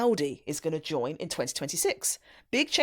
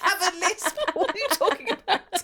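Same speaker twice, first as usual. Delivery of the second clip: high, laughing indignation; laughing protest; 13 semitones higher, 5 dB louder than usual. Real, not acted. Host teased about a lisp, protesting with a laugh. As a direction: protest through the giggles.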